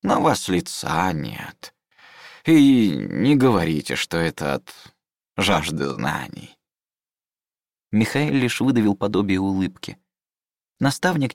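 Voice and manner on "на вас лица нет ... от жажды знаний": put-on slow, pedantic old scholar; old mentor voice.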